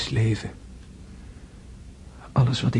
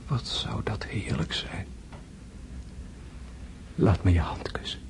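tender whisper; 2 semitones lower, 6 dB quieter than usual. Acted. Dying André whispering tenderly.

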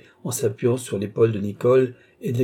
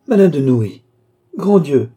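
loud shocked outcry, higher; horrified exclamation; 5 semitones higher, 10 dB louder than usual. A narrator's reading. Maid aghast at the duchess's name, loud outcry. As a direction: cry out in dismay.

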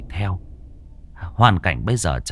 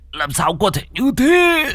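very high wailing cry; shrieked, despairing question; anguished disbelief; 14 semitones higher than usual. Acted. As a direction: cry out in horrified denial.

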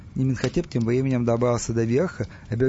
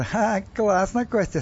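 higher, drawn-out gloating with a scoff; 8 semitones higher, slower than usual. Acted. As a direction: gloat over someone's bad luck. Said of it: "Стас злорадно засмеялся". (злорадно, с насмешкой)

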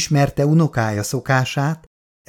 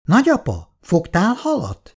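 put-on young child; 6 semitones higher than usual. A narrator's reading. Grandson asking grandfather about fishing, childlike and eager. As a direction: ask eagerly like a small kid.

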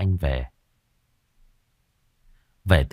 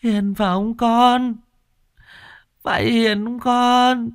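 very high, slow, tearful questioning; a sobbing mother searching for her son; 3 dB louder, slower than usual. Acted. Mother asks the ghost if it is her son, tearful. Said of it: "Dì Tư thấy vậy thì mếu máo".

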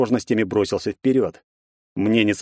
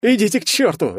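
loud, much higher angry bark; 10 semitones higher, 4 dB louder than usual. A narrator's reading. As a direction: snap at the friends calming him. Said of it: "рявкнул тот". (рявкая)

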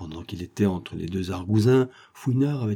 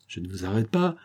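plain reading voice

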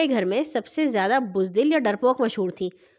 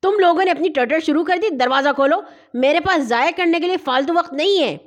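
loud, higher irritated demand; displeased; 6 semitones higher, 4 dB louder than usual. A narrator's reading. Robber berates family for chattering, loudly displeased. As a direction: harangue them impatiently. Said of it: "ناگوار سی آواز".

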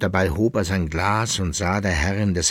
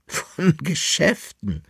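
higher, slow amused echo; laughing; 9 semitones higher, slower than usual. A narrator's reading. The lady mocks his talk of business, laughing. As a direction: laugh the words at him. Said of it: "lachte die Dame".

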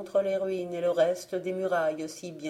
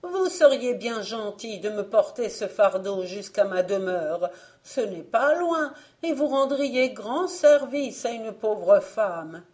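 higher, louder beseeching voice; acted imploring old woman; pleading; 3 semitones higher, 6 dB louder than usual. A narrator's reading. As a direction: beg a favour as a poor old woman. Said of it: "dit-elle d'une voix suppliante".

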